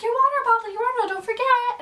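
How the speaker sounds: high, loud nagging grandma call. Retold or imitated. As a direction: call after her like a fussy grandma. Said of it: "My grandma was like".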